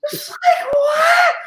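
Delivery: shrieking high disbelief; a squealed outraged what; mock shock. Shocked the audience uses DoorDash, shrieking high. Shocked at other delivery apps.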